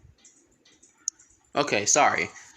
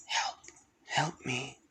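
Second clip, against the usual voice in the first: faint call for help; 8 dB quieter, slower than usual. Acted. Mysterious voice calls faintly.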